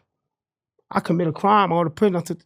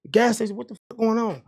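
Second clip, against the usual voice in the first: retold angry rant; 6 semitones higher than usual. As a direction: vent at the lawyer, pitched up and fired up. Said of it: "I'm pissed off".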